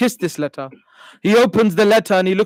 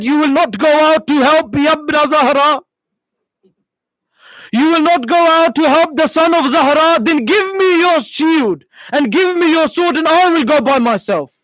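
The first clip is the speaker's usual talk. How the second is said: tearful wife; 5 semitones higher than usual. Acted. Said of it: "his wife begins to cry and says".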